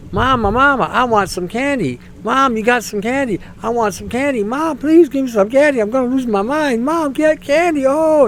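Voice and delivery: put-on high, whiny child pleading for candy; begging child. Mocking politicians as a child begging mom for candy, high whine. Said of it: "A little child saying".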